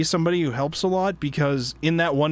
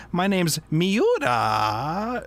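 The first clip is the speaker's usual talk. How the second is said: very slow, higher-pitched drawn-out introduction; stretched-out vowels; 4 semitones higher, much slower than usual. Retold or imitated. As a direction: imitate him by dragging the vowels out. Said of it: "he's, like, stretching out his, like, vowels a long time".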